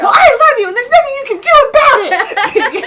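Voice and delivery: high, very loud hammed-up retort; full-volume comic fury; mock mean yell. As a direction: scream love at them as if it were hate. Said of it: "a really mean way".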